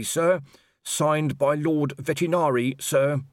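put-on dutiful young constable. A narrator's reading. Constable Shu politely asking Colon for the wages chitty. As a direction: report earnestly to your captain.

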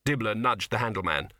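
ordinary delivery, nothing marked

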